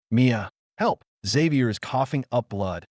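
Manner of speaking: usual speaking voice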